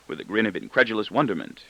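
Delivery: ordinary delivery, nothing marked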